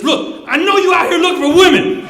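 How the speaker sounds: loud, knowing insistence; insistent accusation. Retold or imitated. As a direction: push the accusation loudly. Cop insisting, acted in retelling.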